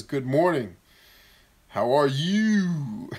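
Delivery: drawn-out, teasing singsong hello; mock chipper greeting. Reading a viewer's joke greeting, mock chipper and drawn out. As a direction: stretch it slowly with exaggerated cheer. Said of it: "Chipper people in the morning".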